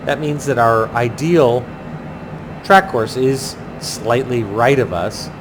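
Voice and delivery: usual speaking voice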